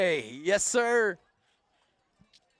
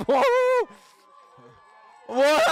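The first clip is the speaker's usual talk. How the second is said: a sudden startled What, pitched up and loud; surprised shout; 9 semitones higher, 4 dB louder than usual. Real, not acted. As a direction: blurt it out in real surprise. Announcer surprised by a rider.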